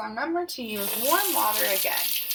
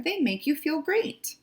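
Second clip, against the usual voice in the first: high, bright friendly bear greeting; Bear's friendly voice; 7 semitones higher than usual. Acted. Bear warmly greeting Skunk, high and friendly. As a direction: greet him like a cheerful bear.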